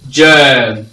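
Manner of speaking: GERM with a long, held "air" vowel, loud. Shown as a demonstration. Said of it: "the long vowel sound air".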